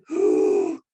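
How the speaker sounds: sharp storyteller's gasp; loud suspenseful intake of breath; dramatic gasp. Acted. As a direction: gasp in mock alarm. Dramatic gasp before scary part.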